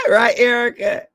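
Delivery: loud, high call to a friend. Real, not acted. Calling out to Erica in the live chat, loud and high. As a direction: shout her name across the room.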